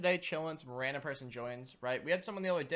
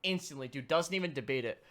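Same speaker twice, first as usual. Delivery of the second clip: ordinary talk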